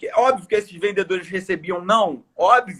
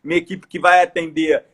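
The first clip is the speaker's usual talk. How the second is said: ordinary talk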